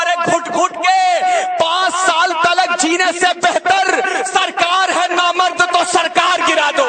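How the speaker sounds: high and loud, slow, stretched out; shouted verse climax. Acted. Poet bringing the couplet home to the cheering crowd, high slow shout. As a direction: hurl each word at the hall.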